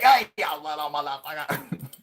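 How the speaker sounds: put-on high, loud cranky geezer shout at kids; grumpy old man yelling. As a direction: bellow like an old crank chasing kids away. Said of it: "I just start yelling at kids".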